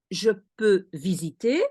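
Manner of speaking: "Je peux visiter" with a rising end; "Je peux visiter" modelled as a question, pitch climbing at the close; question intonation. Shown as a demonstration. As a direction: lift the last syllable of "visiter" to ask. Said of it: "you use the intonation of a question".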